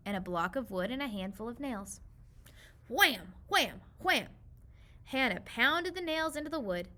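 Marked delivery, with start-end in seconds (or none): 2.9-4.3 punchy loud wham cries; three sharp hammer bangs; wham sound effects. A narrator's reading. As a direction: bang each one out like a hammer blow.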